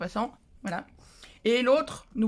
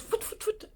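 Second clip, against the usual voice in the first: whispered rushing noises; 11 semitones higher, 4 dB quieter, slower than usual. Retold or imitated. Mimicking rushing.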